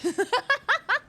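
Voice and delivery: high bright burst of laughter; short loud laugh at the joke. Real, not acted. Laughing at a chat joke about fake Spanish, loud burst.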